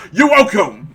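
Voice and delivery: loud, high pointed call; mock shout. Calling after an ungrateful person at the door, mock shout. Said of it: "I just shout".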